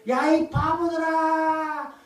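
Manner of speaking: high, drawn-out taunting calls; mocking jeer. Jericho's people taunting the silent marchers, mocking jeer. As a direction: jeer down at them, stretching out each line's end. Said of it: "조롱하고 비웃기 시작하지 않았겠습니까".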